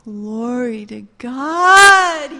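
long drawn-out, swelling loud shout; exultant praise. Acted. Rahab rejoicing at the sound, shouting exultant praise. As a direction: stretch each word, building to a big cry. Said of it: "she was just like".

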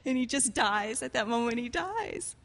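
ordinary talk

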